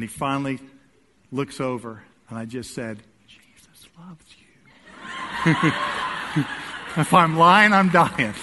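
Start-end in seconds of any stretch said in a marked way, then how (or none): 3.3-5.7 teasing whispered blessing. Retold or imitated. Whispered to driver at red light. 5.8-6.9 stifled whispered laugh. Retold or imitated.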